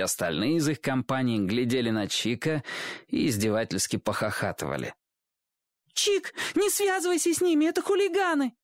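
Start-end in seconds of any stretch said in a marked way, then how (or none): 5.9-8.5 very high, squeaky warning cry; alarmed high-pitched child's call; girl calling out. A narrator's reading. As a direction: cry out a warning in a little girl's piping voice. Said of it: "крикнула Сонька".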